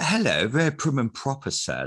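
high, loud, prim and proper English accent. Shown as a demonstration. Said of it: "doing an English accent".

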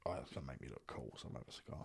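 low, barely voiced aside to himself; retold thought, sunk very low and soft; muttered inner thought. Drunk teenager talking himself into a cigar, low muttered thought.

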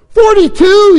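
very high, loud, incredulous shout; outraged yell. A narrator's reading. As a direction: yell in disbelief. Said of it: "yelled Lun Kuo".

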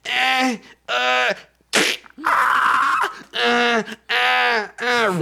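high, repeated straining grunts; mock effort grunts. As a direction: grunt through a hammed-up struggle, one effort at a time. Miming struggle with bent finger.

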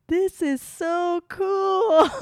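high, slow, wide-eyed awe; drawn-out marvel at the view; amazed. Retold or imitated. Bride walking down the aisle, high drawn-out awe. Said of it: "I'm like".

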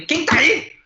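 high, loud challenge; rude, annoyed demand. Retold or imitated. Man retelling confronting a spirit, rude angry shout. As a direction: bark it at the spirit, fed up. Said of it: "eu já tava meio puto".

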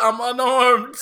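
laughing joke. Real, not acted. Joking line in laughter.

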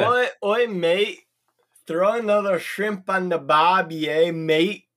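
conversational mock Australian, matey lilt; Australian accent. Player practises talking like from down under, Australian accent. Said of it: "talk like you're from down under".

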